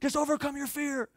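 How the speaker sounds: high-pitched exasperated urging; mock freaking out. How the uncle did not urge the girls, freaking out. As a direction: imitate someone losing patience in a panic. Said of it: "He's not freaking out. He's not being like".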